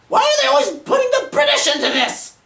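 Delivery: very high, loud outburst; exasperated protest. Acted. Moviegoer objecting to a Roman's British accent, exasperated outcry. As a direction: cry out in exasperation.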